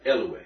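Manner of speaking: "Elue" stressed on the opening E; accent on first syllable. Shown as a demonstration. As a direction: hit the first vowel of "Elue". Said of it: "and accent like that".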